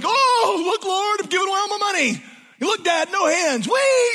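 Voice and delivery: high, giddy squeals of a man giving it all away. Retold or imitated. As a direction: gush like a kid showing off on his bike. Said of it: "I hear Zacchaeus almost being giddy".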